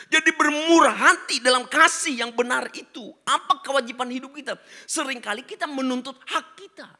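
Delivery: loud, high, driving sermon voice; rally the congregation at full force; fiery preaching. Real, not acted. Preacher exhorts the congregation to be fair and kind, loud and fervent.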